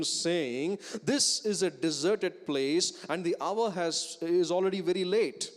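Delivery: preacher reading the verses aloud with force; scripture reading. Acted. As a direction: proclaim the verses to the congregation.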